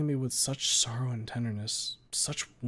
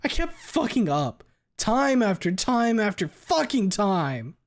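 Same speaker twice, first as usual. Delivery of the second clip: much higher, louder anguished yell, slow; screaming; 11 semitones higher, 8 dB louder, slower than usual. Acted. Anna screaming self-blame at the therapist. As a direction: scream it out at herself. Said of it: "I shout with a stomp".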